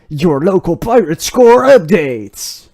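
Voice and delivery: loud, slow show announcer. Acted. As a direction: announce the segment loudly.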